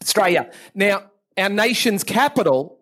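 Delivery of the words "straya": "Australia" squashed into "Straya"; like stray dog. Shown as a demonstration. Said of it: "Like a stray dog".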